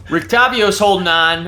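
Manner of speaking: ordinary talk, nothing marked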